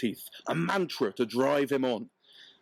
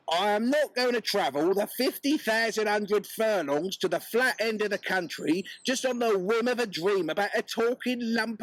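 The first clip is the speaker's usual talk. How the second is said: much higher, indignant Cockney wife; wife laying down the law; 9 semitones higher than usual. Acted. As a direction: put her foot down on the husband.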